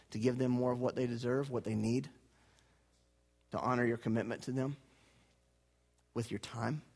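low, soft earnest voice; hushed sincere plea about family time; tender appeal. Acted. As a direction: speak gently to each parent in the room.

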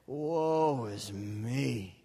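slow, low, dragged-out moan of woe; really depressed. Shown as a demonstration. Preacher leading the church in a slow, depressed lament. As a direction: sigh it out like all hope is gone. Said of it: "Let's say that all really depressed".

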